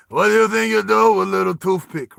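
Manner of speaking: big, loud Russian tough; Russian accent. Acted. Scarred Russian mocks Roy's throwing knives, loud Russian accent. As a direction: taunt in a thick Russian accent. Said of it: "the Russian guy leans over to Roy's character".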